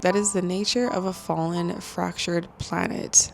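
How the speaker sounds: hushed voice, soft so nobody wakes; whispering. Real, not acted. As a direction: keep it down, someone's asleep next door. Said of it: "I am gonna whisper because Jason's literally sleeping".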